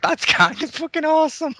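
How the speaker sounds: excited. Retold or imitated. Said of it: "But they were like".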